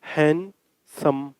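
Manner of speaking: हैंडसम said very slowly, both syllables drawn out; teacher stretching हैंडसम to count syllables; slow syllable demo. Shown as a demonstration. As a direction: draw out हैंडसम so each mouth opening shows. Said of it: "हैंडसम बोल के देखो".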